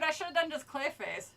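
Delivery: ordinary delivery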